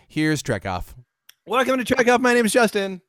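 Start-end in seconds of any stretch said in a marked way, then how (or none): 1.5-3.0 higher energetic host voice; energetic host welcome. Acted. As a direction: open the show like a bright host. Host opening.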